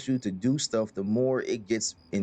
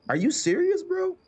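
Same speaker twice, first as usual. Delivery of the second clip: high disbelieving squeal, much higher; incredulous squeak. Retold or imitated. Player reacting to murder orders, high disbelieving squeal. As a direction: shoot up into a stunned falsetto. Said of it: "and it's just like".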